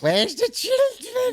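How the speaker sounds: put-on cackling storybook child snatcher shout; Child Catcher voice. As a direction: creep and screech like a pantomime baddie. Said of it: "the child catcher out of Chitty Chitty Bang Bang".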